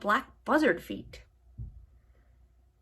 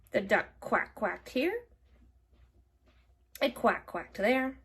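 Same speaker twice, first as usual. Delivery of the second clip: plain reading voice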